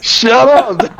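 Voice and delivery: high pleading protest; mock outraged. Real, not acted. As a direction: beg him to stop, half laughing. Friend teasing about price crash.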